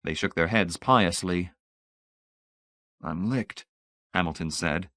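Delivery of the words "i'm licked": much softer, dazed; stunned disbelief. A narrator's reading.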